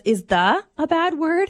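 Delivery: put-on high, slow questioning kid voice; slow question rising high at the end; imitated six-year-old girl. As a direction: mimic a child checking word by word. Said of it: "we have a six-year-old daughter".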